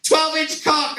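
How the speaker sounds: high shouted cry; screamed catchphrase. Retold or imitated. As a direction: belt it out like a host. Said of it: "she'd be like".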